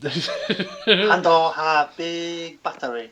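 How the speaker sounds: put-on very slow Japanese accent, laughing. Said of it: "in your best Japanese accent".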